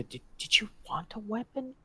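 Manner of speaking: hushed in-character whisper. Acted. Adventurer secretly offering companion a weapon, whispered. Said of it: "you're whispering this to me".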